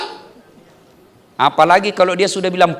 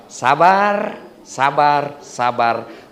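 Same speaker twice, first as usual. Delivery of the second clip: each sabar softer and lower, dying away; sabar, sabar, sabar fading to a murmur; fading repetition; 8 semitones lower, 3 dB quieter, much slower than usual. Shown as a demonstration. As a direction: let sabar sink lower each time, trailing off. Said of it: "repeated word fading".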